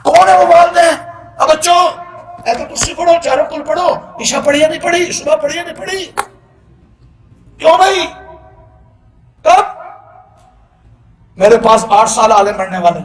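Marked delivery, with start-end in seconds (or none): 0.0-9.6 high, raised questioning voice; calling out sternly. Acted. Parent asking children about their prayers, raised stern questioning. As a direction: call out and demand an answer.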